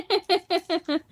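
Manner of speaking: short bright burst of laughter. Real, not acted. Sister laughing at her brother's runny nose joke.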